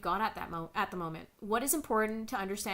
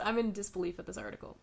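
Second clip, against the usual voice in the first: normal talking voice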